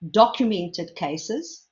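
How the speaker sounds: documented said slowly and deliberately; stress on documented. Real, not acted. Lecturer to audience, stressing documented slowly. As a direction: lean on documented, slowing down. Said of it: "I want to emphasize the word documented".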